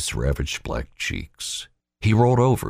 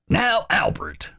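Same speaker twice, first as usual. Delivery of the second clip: raised, slow, commanding; Wallace's voice; slower than usual. Acted. Wallace putting Albert in charge, commanding. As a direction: lay out the orders firmly.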